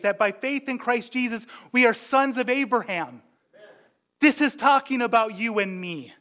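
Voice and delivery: normal talking voice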